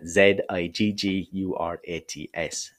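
slow, letter by letter, Z as zed; spelling out. Shown as a demonstration. Teacher spelling ziggurats for listeners, slow letter-by-letter spelling. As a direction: spell Z I G G U R A T S slowly. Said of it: "spelled Z or Z".

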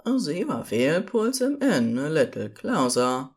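ordinary delivery

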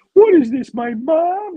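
high, loud cry of disbelief; hammed-up high wail from the wronged wrestler; shocked wrestling opponent. Opponent hit with his mother's photo, high-pitched shock. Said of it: "And he's like".

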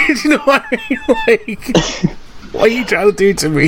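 ordinary delivery

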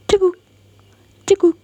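high loud hiccup sounds; scared hiccups. Acted. Frightened boy hiccupping at octopus, high. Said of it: "makin sering dia cegukan".

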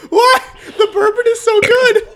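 very high, loud, helpless laughing; giddy high-pitched outburst, drawn out; laughing squeal. Real, not acted. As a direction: squeal it slowly through laughter. Giddy reaction amid burning mouth.

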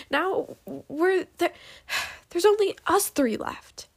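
high, halting, exasperated; falter, sigh, then burst out; upset outburst. A narrator's reading. Upset outburst about what was lost.

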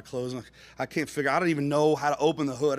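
normal talking voice

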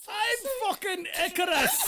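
high, hammed-up Scottish boast; Scottish accent. Introducing himself as Icarus, high comic Scottish accent. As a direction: announce yourself in a daft Scottish accent. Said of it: "It's the only Scottish accent I've got".

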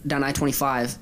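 normal talking voice

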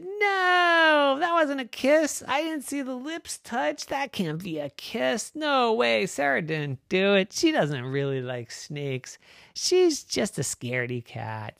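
put-on high, insistent girl voice; indignant little sister. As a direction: protest it wasn't a kiss. Mary disputing the kiss.

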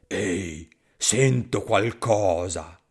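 low, slow monster voice; hungry monster. Acted. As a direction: rumble it like a big hungry beast. Gruffalo hears something.

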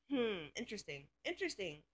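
a dry, knowing hmm, said softly to yourself; skeptical hmm. Retold or imitated. Wary inner reaction to neighbor.

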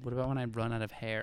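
soft whispered joke; ASMR whisper. Pretend. Joining ASMR whisper bit.

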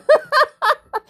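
loud high-pitched laughing burst; high cackling laugh in the retelling. Retold or imitated. Laugh inside the acted quote.

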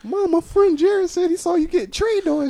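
put-on high, slow little kid; squeaky boy asking; child voice. Voicing a young son.

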